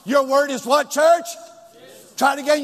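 ordinary talk, nothing marked

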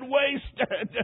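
high, mock-sobbed cry; acting out a tearful wail; imitated sobbing. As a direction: sob the word out as if spent. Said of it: "he sobbed and said".

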